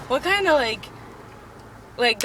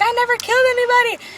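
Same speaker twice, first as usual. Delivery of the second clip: high, loud retold denial; hammed-up shouted protest; mock outraged yell; 12 semitones higher, 7 dB louder than usual. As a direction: yell it like a soap opera villain protesting. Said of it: "they're screaming at him".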